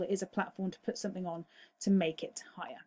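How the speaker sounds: breathy whisper, no voice at all; softly whispered key answer; whispered restatement. Shown as a demonstration. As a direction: whisper the correct answer as a quiet recap. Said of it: "that's not the correct use of the term pedestal".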